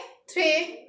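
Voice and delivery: थ्रे with a rolled French tr sound; French pronunciation. Shown as a demonstration. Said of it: "लेकिन French में क्या बोलते हैं?". (French लहजे में थ्रे)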